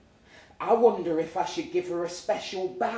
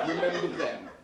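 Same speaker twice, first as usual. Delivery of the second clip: low drawl. Retold or imitated. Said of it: "low drawl of his".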